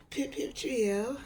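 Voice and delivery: hushed mock British. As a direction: murmur it like an English gent. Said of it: "some type of accent".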